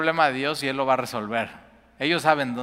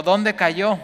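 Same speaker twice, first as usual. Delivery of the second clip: asking; 7 semitones higher, 7 dB louder than usual. Acted. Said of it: "el varón de Dios preguntó".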